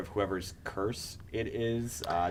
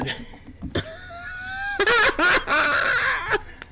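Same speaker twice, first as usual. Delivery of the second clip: loud laugh; 7 dB louder than usual. Real, not acted. Big laugh at joke.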